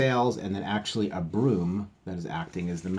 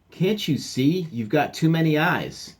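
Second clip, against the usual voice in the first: high, louder exasperation; exasperated captain; 6 semitones higher, 4 dB louder than usual. Acted. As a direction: correct the silly mate.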